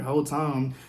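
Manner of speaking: ordinary talk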